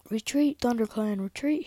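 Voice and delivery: high, loud battle call; urgent shout, pitched well up; yelled command. Acted. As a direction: yell the order to the whole troop. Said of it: "he yelled".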